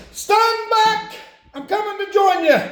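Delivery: high, loud hammed-up cry to the heavens; mock dramatic cry. Hillbilly in milk tank hamming up a dying cry. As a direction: wail it out like a fake deathbed plea.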